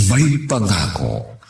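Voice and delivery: usual speaking voice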